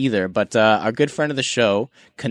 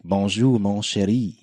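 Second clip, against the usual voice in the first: mock French accent; 3 semitones lower than usual. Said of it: "He's gonna hit us with his French accent like".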